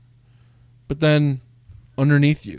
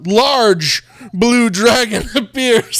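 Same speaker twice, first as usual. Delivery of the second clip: dramatic reveal; 6 semitones higher, 6 dB louder, much slower than usual. Acted.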